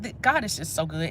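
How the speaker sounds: ordinary talk, nothing marked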